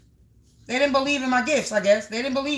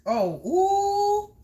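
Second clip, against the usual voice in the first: surprised cry; 6 semitones higher than usual. Real, not acted. Reacting to a card.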